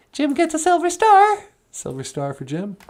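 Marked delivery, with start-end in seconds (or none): 0.1-1.4 very high, bright greeting; a cheerful high puppet hello; [name]'s puppet voice. Acted. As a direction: pitch way up for the puppet. Puppet [name] awards star.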